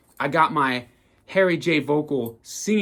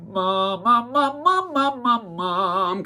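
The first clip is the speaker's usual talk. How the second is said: higher nasal mom-mom drill; nose plug exercise; 10 semitones higher, 3 dB louder, much slower than usual. Shown as a demonstration. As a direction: pinch the nose and sing mom. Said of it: "that nose plug".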